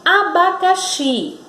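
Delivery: "abacaxi" in even, distinct syllables; careful pronunciation. Shown as a demonstration. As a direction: say a-ba-ca-xi clearly for "abacaxi". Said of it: "Na palavra".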